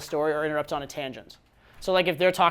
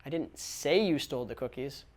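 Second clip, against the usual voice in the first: softer, weight on "say", rising at the end; stress on say; 2 semitones lower, 5 dB quieter than usual. Shown as a demonstration. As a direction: push "say", as in it was only implied. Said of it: "See how many different ways you can say it".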